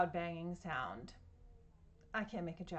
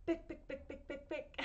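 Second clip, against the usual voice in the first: higher, quick bick bick bick; quick heel clicks; 6 semitones higher, 3 dB quieter than usual. Shown as a demonstration. Telling kids about a running lady: quick bick. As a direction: tap it fast like running heels. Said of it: "The lady starts to run".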